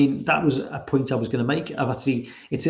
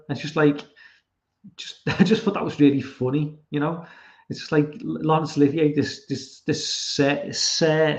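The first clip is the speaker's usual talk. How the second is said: ordinary talk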